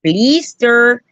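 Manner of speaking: loud, high mock-Spanish "Blister"; hammed-up Spanish accent. Shown as a demonstration. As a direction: say "Blister" as "BLEE-ster", fake Spanish. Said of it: "how they say that in Spanish".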